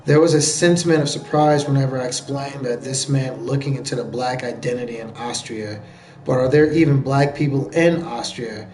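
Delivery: even, subdued article reading; reading aloud. Real, not acted. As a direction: read it off the page in a low-key, steady voice.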